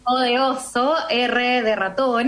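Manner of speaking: slow, careful letter-by-letter spelling; over enunciating. Shown as a demonstration. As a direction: spell it out slowly and clearly. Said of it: "lo voy deletreando así".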